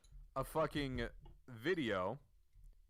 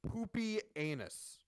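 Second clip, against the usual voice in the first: drawn-out Poopy Anus, higher; deadpan reveal; announced punchline; 9 semitones higher, slower than usual. Shown as a demonstration. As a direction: savor each syllable of "Poopy Anus" slowly. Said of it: "named, I shit you not".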